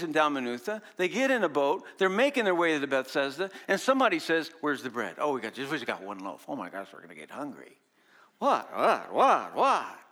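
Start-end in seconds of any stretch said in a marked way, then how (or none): 4.6-7.8 low, fretful muttering about the bread; worried disciples. Acted. Disciples voiced fretting about bread. 8.4-10.0 disbelieving repeated what; mock disbelief; incredulous. Preacher scoffs at their worry.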